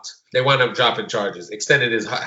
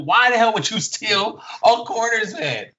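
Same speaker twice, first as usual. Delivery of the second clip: higher, changed voice, baffled; exasperated disbelief; 9 semitones higher than usual. Retold or imitated. Teacher demanding why the student stole the van, higher and baffled. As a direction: ask it as if nothing makes sense.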